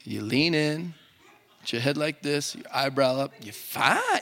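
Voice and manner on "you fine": high-swooping Southern accent on FINE; Southerner. Shown as a demonstration. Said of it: "say it like a Southerner".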